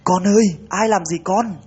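rú lên; crying out. Acted. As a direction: howl it at the phone in terror. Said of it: "khiến cho bà Thoa rú lên".

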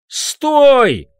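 high, loud yell to stop. Acted. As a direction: shout after a fleeing figure. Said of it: "завопил я".

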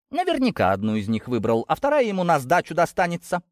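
spiteful jibe, pitch climbing; venomous. A narrator's reading. Girl mocking the absent suitor to her cousin, venomous. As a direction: sneer it, rising on the second half. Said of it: "продолжала пылать ядом Кристина".

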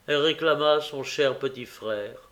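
plain reading voice

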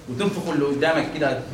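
usual speaking voice

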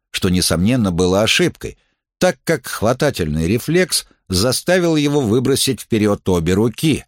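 ordinary delivery, nothing marked

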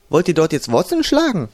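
called out. A narrator's reading. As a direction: call them on from behind. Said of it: "rief die Kommandantin von hinten". (von hinten gerufen)

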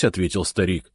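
ordinary delivery, nothing marked

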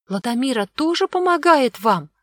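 high-pitched, wide-eyed question; shocked little girl's voice; astonished girl. A narrator's reading. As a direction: ask in childlike disbelief. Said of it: "округлила глаза девочка".

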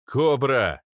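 urgent calling out; shouted name. A narrator's reading. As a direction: yell for someone across a crowd. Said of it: "подхватил Арнольд".

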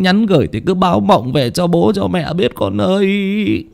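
high, drawn-out grieving plea to a dead son; tearful lament. A narrator's reading. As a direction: start on a long call, climb into a keening lament. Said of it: "miệng lẩm bẩm nước mắt dâng trào". (than khóc nghẹn ngào, giọng cao, kéo dài)

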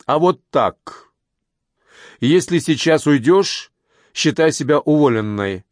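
bossy, deliberate warning; stern threat. Acted. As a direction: lay down the law to a subordinate, word by word. Boss cuts in, threatening dismissal.

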